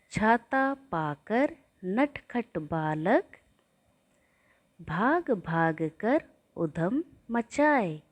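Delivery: put-on slow sing-song lines for children to echo; poem for repeating. Shown as a demonstration. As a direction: recite slowly so the class can repeat after you. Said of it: "इसे भी आप मेरे पीछे बोलकर दोहराते जाइए". (बच्चों को दोहराने के लिए धीमी लय में कविता पाठ)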